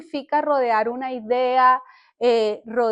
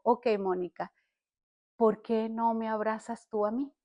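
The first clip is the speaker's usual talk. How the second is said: softer retelling of God's tender question; gentle divine question; 2 semitones lower, 7 dB quieter than usual. Retold or imitated. Holy Spirit asks Mónica for a hug, gently.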